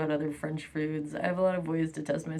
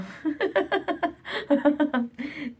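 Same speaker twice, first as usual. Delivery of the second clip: high laugh; 9 semitones higher, 3 dB louder than usual. Real, not acted. Laughs at own confusion.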